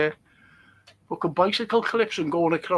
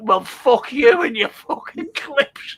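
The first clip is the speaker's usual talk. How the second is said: mock indignation, higher and drawn out; retold angry retort; 5 semitones higher, 4 dB louder, slower than usual. Lad refusing farmer's bicycle clips, slow mock-indignant retort. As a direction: stretch out the retort, playing indignant.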